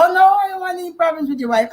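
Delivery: quick, high-pitched protest; retold backing-off. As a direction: back out hastily, voice jumping up. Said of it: "he said".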